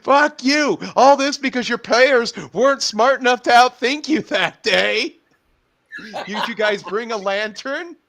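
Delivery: hammed-up indignation; mock outrage. Acting out a heated retort.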